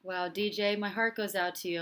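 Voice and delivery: ordinary delivery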